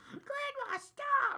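put-on shrill child's cry, very high; high kid scream. Kid voiced pleading in story.

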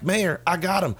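mock excited announcement. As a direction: announce a catch, hammed-up. Acting out Ernest's triumphant announcement.

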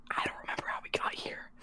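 secretive whisper into an ear; hushed, voiceless confession; retold whisper. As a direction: whisper it softly, confiding. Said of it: "whispers in my ear".

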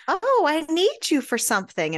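high-pitched interrupting excuse; feigned urgency. Retold or imitated. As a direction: pipe up suddenly to pull someone away. Petey makes excuse to grab Rosalie.

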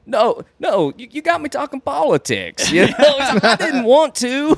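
high, laughing mock apology; sheepish high cowboy voice. Cowboy backing out of a political argument, high mock apology. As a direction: squeak it out like a caught kid.

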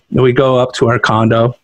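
normal talking voice

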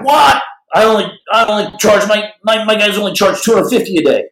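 high, fast indignant squawk at the price; mock shocked boss; indignant surprise. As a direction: shoot up high in outraged disbelief. [name] balking at the price.

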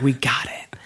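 hushed reverent whisper. Retold or imitated. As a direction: breathe it like a treasure. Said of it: "You're like".

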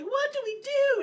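put-on very high, loud scared-girl squeak; scared Gretel. Gretel asking her brother what to do, very high and loud. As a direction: shriek it like a frightened little girl.

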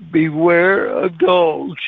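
drawn-out punch line reading; slow, deliberate verse reading. Retold or imitated. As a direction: read it out slowly, stretching each word. Reads verse slowly for punch line.

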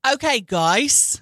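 slow Aussie drawl on "Okay, guys"; Australian accent. Retold or imitated. Repeating the actor's Australian greeting to a friend. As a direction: lean into a lazy Australian vowel. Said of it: "That was Australian".

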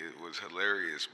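ordinary delivery

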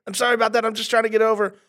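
high, rapid-fire mock apologies; frantic apologizing. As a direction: spam sorry in a panicky high voice. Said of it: "You're going".

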